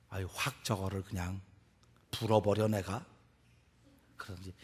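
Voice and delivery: low, soft grumble to oneself; acted private grudge, held down; muttered resentment. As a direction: mutter the threat under your breath. Acts resentful person's private thought.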